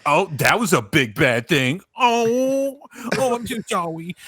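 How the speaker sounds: mock-quippy movie star, pitch climbing; Robert Downey Junior. Film fan mocking smug superhero dialogue, sarcastic. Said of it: "like, Robert Downey Junior".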